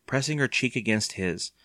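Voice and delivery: usual speaking voice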